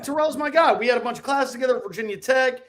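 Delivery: mild excited self-quote; excited brag. Retold or imitated. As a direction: brag happily about a friend. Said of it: "I'm like".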